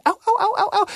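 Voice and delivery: high, steady wailing oh's of a fleeing granny; long high cries running down the road; hollering granny. Acted. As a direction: holler as if the gourd's beating her.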